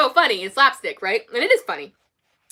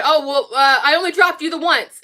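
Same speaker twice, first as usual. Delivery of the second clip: higher, louder mock-panicked excuse; retold panic; 5 semitones higher, 5 dB louder than usual. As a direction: imitate a mother backpedalling nervously. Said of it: "she starts to panic".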